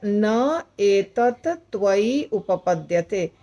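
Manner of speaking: न एतत् त्वयि उपपद्यते recited slowly, each word set apart; slow sandhi recitation. Shown as a demonstration. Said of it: "यानी चार शब्दों का संधि है यहाँ". (संधि तोड़कर धीमा पाठ)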